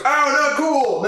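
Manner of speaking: high, loud and slow mock complaint; offended T-Rex. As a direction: whine like a wounded cartoon dinosaur. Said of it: "And he goes".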